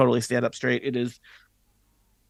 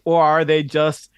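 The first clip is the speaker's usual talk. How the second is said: dramatic announcer; 4 semitones higher than usual. Acted. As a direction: tease the next episode like a TV host. GM's dramatic episode outro.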